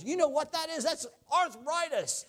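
high mock call from the crowd; old man calling out. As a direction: imitate a resident shouting out. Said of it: "the one guy yelled out".

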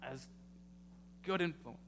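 rising preacher's build to the congregation; preaching. Acted. Pastor urging his congregation, building preaching. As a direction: drive the point home like a sermon.

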